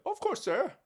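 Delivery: high, nervous butler voice; a hint of a trembling servant.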